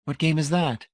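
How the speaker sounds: usual speaking voice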